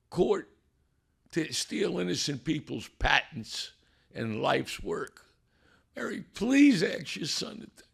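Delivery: high, slow desperate plea; retold prayer, pleading and rising. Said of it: "I really call it pleading, begging".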